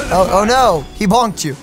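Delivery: ordinary delivery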